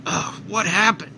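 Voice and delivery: a groggy, changed voice; just woken, dazed and groggy; groggy waking. Acted. Vegeta waking up on the lookout, groggy. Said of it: "who's just woken up".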